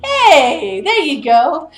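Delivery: loud, high-pitched congratulation; little dragon cheering. Acted. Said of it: "He goes".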